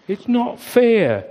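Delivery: high, slow, sulky complaint; loud protest. A narrator's reading. Curly the sheep protesting loudly to the flock. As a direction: whine it out, hard done by. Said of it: "said Curly loudly".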